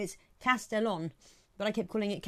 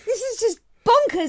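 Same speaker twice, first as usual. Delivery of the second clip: exclaiming; 14 semitones higher, 9 dB louder than usual. Retold or imitated. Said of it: "I'm like thinking".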